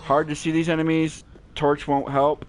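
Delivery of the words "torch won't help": normal talking voice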